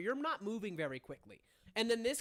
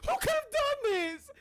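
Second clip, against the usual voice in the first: very high, loud hammed-up wail; screaming in grief; 9 dB louder than usual. Daughter finding her father dead, screaming wail. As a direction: shriek it tearfully, drawing out the words. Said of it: "who is fully screaming".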